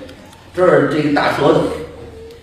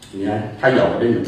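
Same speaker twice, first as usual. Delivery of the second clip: ordinary talk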